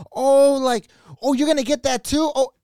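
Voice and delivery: high, loud mock gabbing; hammed-up high-pitched gossip; chatty lady. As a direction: gush to a friend across the aisle. Voices chatty lady gabbing with friend.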